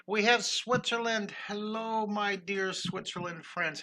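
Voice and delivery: high, bright welcome to the viewers; excited greeting. Real, not acted. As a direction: greet them like long-lost friends.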